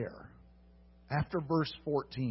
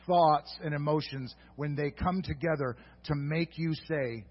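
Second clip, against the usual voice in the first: normal talking voice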